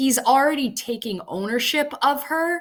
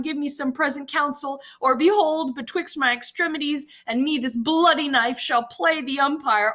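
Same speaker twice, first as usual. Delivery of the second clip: high, insistent acted appeal; desperate speech; 5 semitones higher than usual. Juliet threatens the friar with suicide, high desperate appeal. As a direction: declaim it high, swelling on the wish to die.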